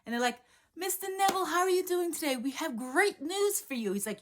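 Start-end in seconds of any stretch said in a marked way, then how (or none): 0.8-3.9 high, bright service voice; a lightly voiced eager clerk; cheerful clerk. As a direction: chirp it like a ticket agent.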